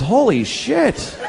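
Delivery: slow, high dawning shock; stunned realization. Retold or imitated. Boy arriving at camp, realizing it slowly with a laugh. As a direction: let the horror dawn slowly.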